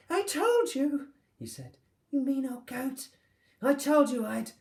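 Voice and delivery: high, loud crowing taunt; gleeful gloating. Acted. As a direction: gloat over the old goat. Boy gloats, laughing at master.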